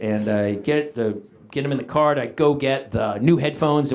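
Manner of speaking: normal talking voice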